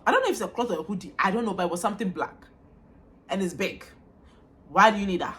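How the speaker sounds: usual speaking voice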